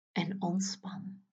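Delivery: slow breathy whisper; whispered release. Acted. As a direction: breathe it out slowly. Release cue after tensing.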